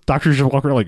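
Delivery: normal talking voice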